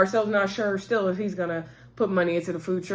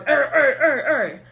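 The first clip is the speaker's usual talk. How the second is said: loud, higher-pitched repeated "er"; mock grunts in a quick string; imitating Bernard's grunts; 4 semitones higher, 4 dB louder than usual. Recalling Bernard being funny in the scene, imitated grunting. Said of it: "it kinda was funny with Bernard's".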